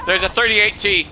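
urgent callout. Real, not acted. Player warning teammate of a tank, urgent callout. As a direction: alert the squad to sudden danger.

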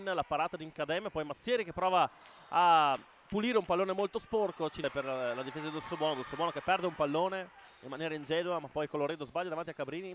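fast, loud live play-by-play; breathless italian goal commentary. Acted. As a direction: call the action as it happens.

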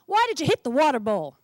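puppy barking at its own tail; angry bark. Acted. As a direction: snap it at your own tail. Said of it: "bark at my rear end".

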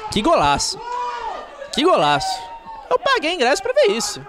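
high cheering golaço; excited goal shout. Real, not acted. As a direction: shout it with delight. Repeated goal celebration. (grito animado de golaço)

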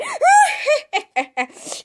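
high, far-off evil cackle of a killer doll. Acted. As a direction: cackle like a possessed toy, high and gleeful. Chucky doll appears at night.

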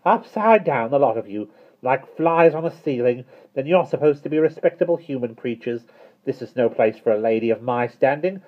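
high, indignant scolding; angry. A narrator's reading. Miss Tartlett leaving the house in disgust, angry. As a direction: scold them with affronted dignity. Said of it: "She flounced angrily toward the door".

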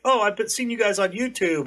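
high-pitched imitation of an eager fan; excited fan. Fan who has seen them on YouTube, excited. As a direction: gush like a viewer meeting video creators. Retelling fans recognizing them.

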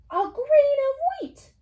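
high, slow amazed hen; excited hen voice. Acted. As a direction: gasp it out in wonder. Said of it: "said the Little Red Hen".

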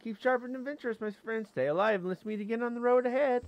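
high-pitched little critter voice; high, cheerful creature goodbye; friendly creature farewell. Acted. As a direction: send a friend off as a small creature. Reads creature's game dialogue.

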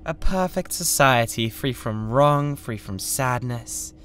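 grand vision. Acted. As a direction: proclaim the dream, swelling up.

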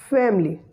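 "family" as two syllables, FAM-lee; schwa after M deleted. Shown as a demonstration. Teacher showing the silent schwa, "family" said as FAM-lee. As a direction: run M straight into L in "family". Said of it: "will be pronounced as".

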